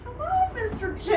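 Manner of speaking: high sing-song hello; excited greeting. Real, not acted. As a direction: call out a happy hello. Guest greets host cheerfully.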